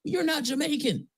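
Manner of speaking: very high incredulous retort; high-pitched disbelief. Retold or imitated. Telling off the fake Jamaican, high-pitched disbelief. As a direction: call out a faker, voice way up high.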